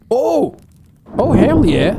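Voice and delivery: sudden high whoop of triumph; excited cheer. Real, not acted. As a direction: shout it out, thrilled. Puzzle solved after long struggle.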